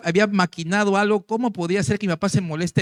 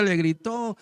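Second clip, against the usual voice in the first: ordinary talk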